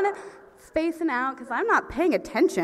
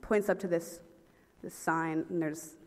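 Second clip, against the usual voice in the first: normal talking voice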